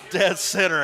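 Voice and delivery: laughing. Real, not acted. Commentator repeating his call of a shot, laughing. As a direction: chuckle the words Dead center out.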